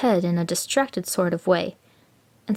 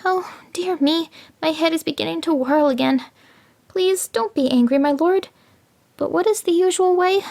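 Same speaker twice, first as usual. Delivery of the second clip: pleading jackal; 10 semitones higher than usual. A narrator's reading. Jackal begs the tiger not to be angry, higher. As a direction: act whirling confusion to bait the tiger.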